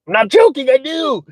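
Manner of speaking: high-pitched comic insistence; squeaky-high retold glee; mock gleeful. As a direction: jump way up in pitch, hamming it up. Retells gleeful reply to his son.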